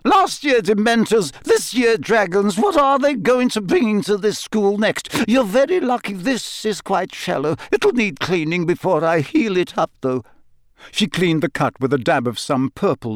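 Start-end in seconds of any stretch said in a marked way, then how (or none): 0.1-10.2 high-pitched indignant rant; furious fussing. A narrator's reading. Matron examining Harry's shoulder, talking furiously. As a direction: scold on in a high voice. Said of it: "talking furiously all the while".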